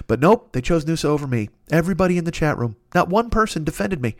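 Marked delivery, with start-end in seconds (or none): none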